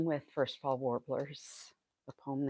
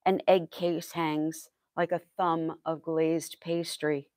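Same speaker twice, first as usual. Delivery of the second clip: measured verse with pauses between phrases; poet's careful, projected recital; poetry reading; 2 semitones higher, 8 dB louder than usual. Acted. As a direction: recite it line by line, evenly paced. Poet reads second poem aloud.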